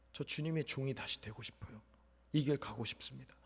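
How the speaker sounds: a soldier vowing to God, softly tearful; tearful quiet prayer. Retold or imitated. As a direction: murmur the vow as if holding back tears. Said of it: "눈물이 이렇게 나더라고요".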